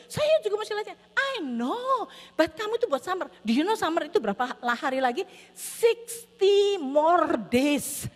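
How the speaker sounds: high, emphatic arguing, slowing hugely at the end; argue, then stretch out the number for a child; exasperated parent. Retold or imitated.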